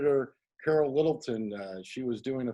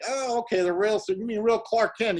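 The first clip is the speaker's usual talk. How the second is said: pitched-up, dawning confusion; mock-baffled young actor, higher voice; puzzled surprise; 8 semitones higher, 4 dB louder than usual. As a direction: figure it out aloud, amazed. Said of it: "I'm like".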